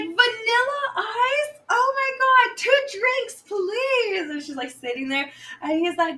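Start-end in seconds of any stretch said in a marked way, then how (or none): 0.0-4.3 much higher, louder, first words slow; star-struck shriek. Retold or imitated. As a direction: gasp at a celebrity. Star-struck at seeing [name].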